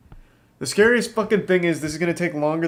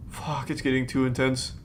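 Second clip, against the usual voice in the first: ordinary talk, nothing marked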